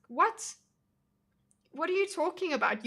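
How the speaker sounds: high, disbelieving what?; incredulous. Real, not acted. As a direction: scoff at the very idea. Scoffing at people's relationship ideas.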